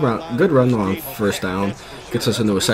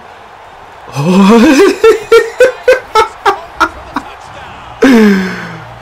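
long, loud, high cackling laugh; hysterical laugh; 13 semitones higher, 9 dB louder than usual. Real, not acted. Streamer breaks free on a return, loud high hysterical laughter. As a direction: burst out laughing in high staccato peals.

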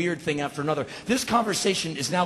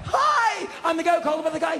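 high, over-eager hello; hammed-up excited caller, pitched way up; frantic phone greeting; 11 semitones higher, faster than usual. Homeowner calling the cops back, frantic and high. Excited second call to police.

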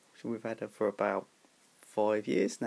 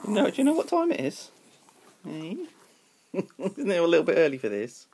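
high mock-indignant pet voice, a touch louder; voiced pet; 6 semitones higher, 4 dB louder than usual. Pet voicing its complaint at owner, high and put-upon. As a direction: protest as the cat woken too early.

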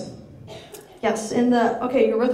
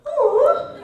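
high, small deflated oh; crestfallen oh. Retold or imitated. Answering the translator, a high hurt oh. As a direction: sound crushed by her remark.

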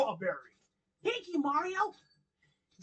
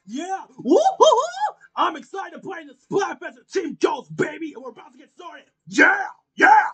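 excited Knuckles voice; 8 dB louder than usual. Acted. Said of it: "my best friend, Knuckles".

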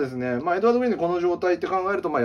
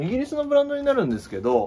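eager sales pitch; 11 semitones higher, 4 dB louder, faster than usual. Acted.

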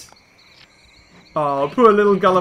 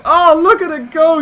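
loud, higher thrill; excited; 7 semitones higher, 6 dB louder than usual. Real, not acted. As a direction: cheer the rampage on. Watching T-Rex rampage.

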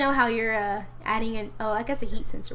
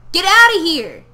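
loud, higher yell to chase him off; mock shout; 6 semitones higher, 11 dB louder than usual. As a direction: bark him out of the house, half joking. Yelling at a game character.

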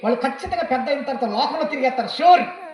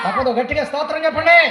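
higher, louder call to praise; loud exhortation; 5 semitones higher, 9 dB louder than usual. Real, not acted. Preacher urging the congregation to praise loudly, shouting. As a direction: demand it from the whole hall. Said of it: "గట్టిగా స్తోత్రాలు చెప్పండి".

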